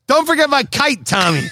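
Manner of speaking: mock Boston caller, starting high; lightly hammed-up Boston phone voice; Ben Franklin. Ben Franklin phoning Tommy about his kite, mock Boston accent. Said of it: "That was more Ben Franklin".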